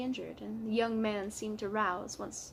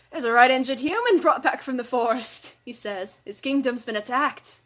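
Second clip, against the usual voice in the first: loud excited dwarf; rough, hurried dwarf villager; gruff dwarf voice; 3 semitones higher, 10 dB louder than usual. Acted. Dwarf comrade voiced in story.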